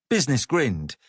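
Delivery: ordinary delivery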